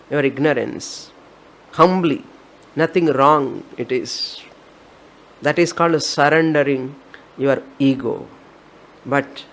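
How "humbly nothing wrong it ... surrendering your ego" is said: ordinary delivery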